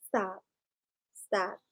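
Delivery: soft, low warning; firm but hushed correction; quiet low command to the dog; low firm stop. Real, not acted.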